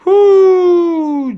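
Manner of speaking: a loud, high whoop of joy; sudden high whoo; excited whoo. Real, not acted. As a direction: cheer the touchdown. Excited cheer at a touchdown.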